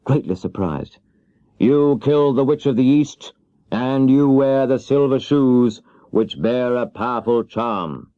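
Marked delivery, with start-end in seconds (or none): 1.6-8.1 slow, weighty wizard decree; solemn wizard. Acted. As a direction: pronounce it like a final judgement. Oz states terms.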